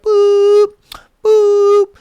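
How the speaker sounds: high, loud held boop; phone tone imitation. Acting out the passenger's call, imitated dial tone. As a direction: mimic a phone tone on one high note.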